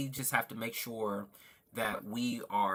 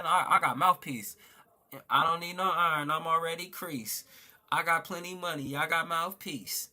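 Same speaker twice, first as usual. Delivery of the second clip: higher, cocky gangster swagger; swaggering movie quote; 6 semitones higher than usual. Pretend. Streamer answering a viewer who says he's still talking, playful higher gangster swagger. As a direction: strut through it like a movie hustler, chuckling. Reciting a tough-guy quote.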